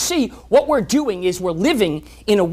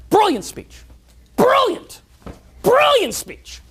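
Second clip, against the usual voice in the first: much higher, ironic gushing praise; mock rave; 14 semitones higher, 4 dB louder, much slower than usual. Praising the president's speech before his death, much higher and gushing. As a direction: gush over it before the punchline.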